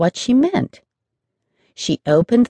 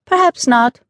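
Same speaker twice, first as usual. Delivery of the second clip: bright, higher and louder light reply; cheerful; 8 semitones higher, 4 dB louder than usual. Acted. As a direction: toss it off happily. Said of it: "the girl answered cheerfully".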